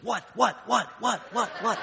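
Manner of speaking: frustrated mom. Retold or imitated. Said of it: "she got so frustrated, turned around, she goes".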